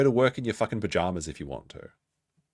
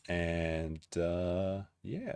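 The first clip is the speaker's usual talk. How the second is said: slowed puppet outro; 8 dB quieter, much slower than usual. Acted.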